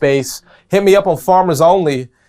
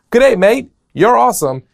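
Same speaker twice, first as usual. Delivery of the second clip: higher, louder Australian accent; attempted Australian accent; 8 semitones higher, 5 dB louder than usual. Shown as a demonstration. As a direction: call it out in your best Aussie accent. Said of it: "that was my best Australian accent".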